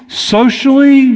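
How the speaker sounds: ordinary delivery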